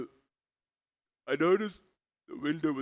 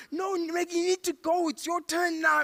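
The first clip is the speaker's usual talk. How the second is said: high-pitched, frantic prodding; hammed-up high panicky voice; panicked urging; 3 dB louder than usual. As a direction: urge a hesitant driver on in a panic. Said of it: "We're panicking at the robots".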